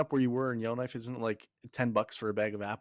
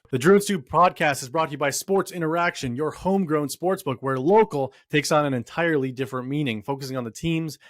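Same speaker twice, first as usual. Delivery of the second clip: loud, upbeat sponsor read; 5 dB louder than usual. Acted. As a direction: sell it like a radio spot. Reads sponsor ad.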